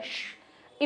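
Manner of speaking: voiceless hissed shh; shushing. Shown as a demonstration. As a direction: hush someone immediately. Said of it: "silent करवाने के लिए".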